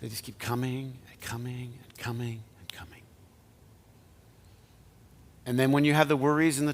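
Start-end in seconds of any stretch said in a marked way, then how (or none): 0.0-3.0 soft hushed whisper; whispered wonder. Acted. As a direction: marvel under your breath.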